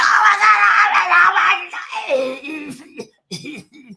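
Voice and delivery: screaming high and loud in panic; panicked. Retold or imitated. In the limo after spraying Ozium in her mouth, loud high panic. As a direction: shriek like you think you've poisoned yourself.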